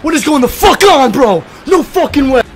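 high, very loud ecstatic shouting; real freak-out. Real, not acted. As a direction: yell to everyone as you lose it with joy. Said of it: "I'm freaking out!".

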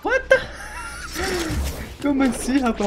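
sharp high startled cry; surprised. Real, not acted. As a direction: caught off guard, pitch jumps way up. Jogador surpreso com kill roubada. (surpreso, agudo)

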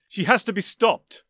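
high, loud, drawn-out shout; alarmed, forceful cry; urgent cry. A narrator's reading. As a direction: call out urgently to rally pursuit. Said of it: "the doctor cried".

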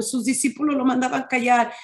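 ordinary talk, nothing marked

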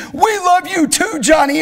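high, loud group-style reply; crowd shouting back. Retold or imitated. Men answering the preacher on stage, high shouted reply. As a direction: call it up to the stage.

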